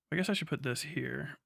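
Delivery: ordinary talk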